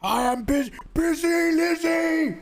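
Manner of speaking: high, loud shout, dragged out slowly; snapping Busy Lizzie. Retold or imitated. Mimicking overloaded friend snapping at chat, hammed-up high shout. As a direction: snap like an overloaded friend finally losing it. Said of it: "she snaps and she, she goes".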